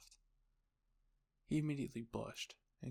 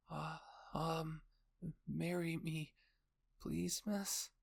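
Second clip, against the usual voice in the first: put-on shy little boy, high and hesitant; 5 semitones higher, 4 dB louder, much slower than usual. A narrator's reading. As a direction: stammer out a bashful, pleading question. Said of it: "he asked shyly".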